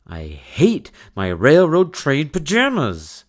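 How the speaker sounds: whiny fed-up outburst; frustrated outburst. Acted. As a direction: stamp it out like a fed-up kid. Child's peak frustration.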